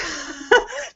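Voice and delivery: quiet, high giggle; soft laugh. Real, not acted. Coach laughs that family never knew, quiet giggle. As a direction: giggle at how funny it was.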